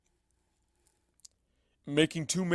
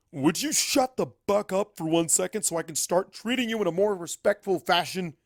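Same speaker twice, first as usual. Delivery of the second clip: shouting; 5 semitones higher, 5 dB louder than usual. Acted. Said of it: "Star Swirl shouts through the door".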